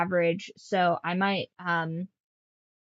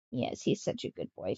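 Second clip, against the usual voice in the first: whisper; 12 semitones lower, 6 dB quieter than usual. Real, not acted. Whispering praise to cat.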